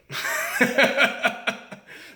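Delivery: high, breathy laugh of disbelief; bitter laugh. Real, not acted. After wondering if he should have taken the part, a high rueful laugh.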